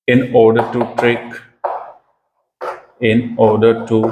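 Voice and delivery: slow phrase by phrase, with long pauses; slow dictation. Shown as a demonstration. Teacher dictating the definition phrase by phrase. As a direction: read the meaning out in chunks for notes. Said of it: "तो इसका meaning क्या है?".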